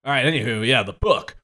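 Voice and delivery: ordinary talk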